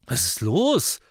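high, loud challenge; challenging. A narrator's reading. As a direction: raise the voice on a defiant question. Sister caught kissing, challenges brother.

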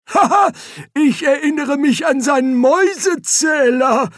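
put-on very high, loud old man's voice; old wizard piping up excitedly from his wheelchair; cackling old wizard.

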